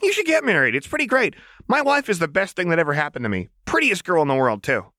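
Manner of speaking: high, excited fond chatter; giddy girl in love; gushing Jinx. A narrator's reading. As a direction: gush about her wife. Jinx gushes about wife.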